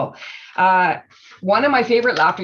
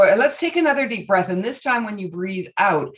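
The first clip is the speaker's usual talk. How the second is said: ordinary talk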